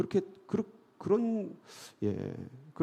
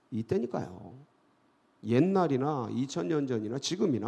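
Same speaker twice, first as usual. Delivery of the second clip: ordinary talk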